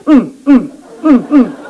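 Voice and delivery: high pleading groans breaking into laughter; groaning prayer, laughing. Shown as a demonstration. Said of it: "이렇게 하면". (높은 음으로 신음하다 웃음이 섞이듯)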